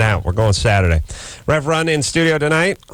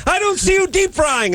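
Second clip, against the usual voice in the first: very high, hammed-up boss yelling; mock yell. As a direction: scold the cook. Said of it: "They must yell at each other".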